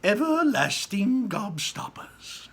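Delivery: high, loud, slow proud announcement; proudly. Acted. Wonka showing off his invention, slow and proud. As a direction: present it like a prize. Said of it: "said Mister Wonka proudly".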